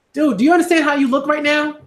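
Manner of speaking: high, exasperated call-out; incredulous scolding. Real, not acted. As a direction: demand he see himself. Scolding YouTubers directly.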